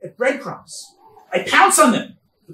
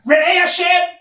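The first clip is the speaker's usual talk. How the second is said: higher and louder, crying out "Re'ei Hashem" to God; calling out; 8 semitones higher, 6 dB louder than usual. Acted. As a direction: call "Re'ei Hashem" up to heaven, loud and high. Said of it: "she called out to HaKadosh Baruch Hu".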